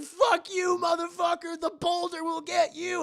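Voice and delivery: high, loud mock-villain screech; screeching demon bat. Demon bat curses the betrayer.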